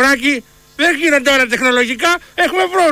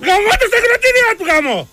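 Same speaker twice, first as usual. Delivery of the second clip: mocking angry rant; 7 semitones higher than usual. Real, not acted.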